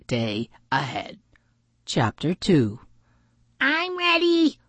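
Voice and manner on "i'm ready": very high, excited duck call; high-pitched, gleeful duck; quacking. A narrator's reading. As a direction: quack it out eagerly. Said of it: "Willow quacked".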